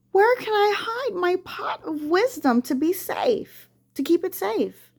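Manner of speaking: high-pitched, fretful spider voice; worried. Acted. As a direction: worry aloud like a small anxious spider. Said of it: "Then Itsy started to worry".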